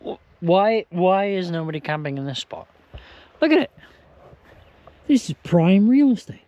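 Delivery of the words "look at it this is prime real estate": excited. Real, not acted. Praising the spot.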